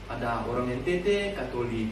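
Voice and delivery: normal talking voice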